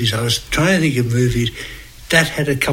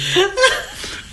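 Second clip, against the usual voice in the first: short laugh. Real, not acted. Laughing at own story.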